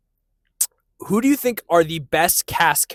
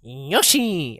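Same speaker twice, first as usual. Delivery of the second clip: a failed attempt at the Yoshi call; botched cartoon dinosaur call. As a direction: try the Yoshi cry and miss. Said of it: "I can't do that".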